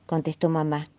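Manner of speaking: ordinary delivery, nothing marked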